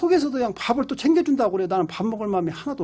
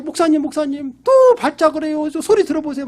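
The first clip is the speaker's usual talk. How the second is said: high, louder pleading voice; imitated distressed caller; 6 semitones higher, 6 dB louder than usual. Parent phoning the pastor about another fit, high urgent imitation. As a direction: act out an urgent phone call for help. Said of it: "그러면은 또 전화가 와요".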